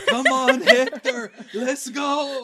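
loud, high mock mom holler; mom calling out. Co-host mimicking mom calling Hector, hammed-up holler. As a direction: holler for your son, laughing.

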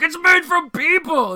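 high, loud hammy scream; mocking a famous movie outburst; Charlton Heston impression. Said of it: "like a Charlton Heston".

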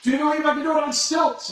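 loud, high call across the falls; yelling to the crowd. Retold or imitated. Tightrope walker shouting to the crowd below, loud and high. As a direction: shout it so thousands can hear. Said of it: "he would yell".

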